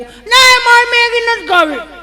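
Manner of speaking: very high, loud, drawn-out whine; bratty child. Acted. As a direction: refuse like a spoilt little kid. Spoilt child refuses food. (જીદ્દી બાળકનો ઊંચો કકળાટ)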